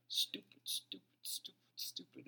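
hushed, breathy stupid, stupid, stupid; whispered self-reproach; self-scolding whisper. Retold or imitated. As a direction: mutter it to yourself in disgust. Self-scolding after blowing it.

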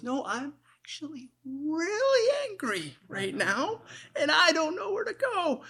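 very high, strained mock-restrained anger; hammed-up suppressed anger, absurdly high; anger held in. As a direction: squeeze comic fury into a tiny high voice, slowly. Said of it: "lower your voice half".

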